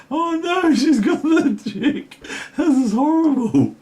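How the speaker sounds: high, laughing, almost crying. Real, not acted. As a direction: wail it through laughter. Laughing at a lost position.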